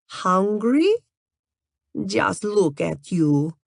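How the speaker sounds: put-on slow, teasing Russian-accented model. A narrator's reading. Natalia flirts with Grayson in a Russian accent. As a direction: purr it in a thick Russian accent. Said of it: "in her thick Russian accent".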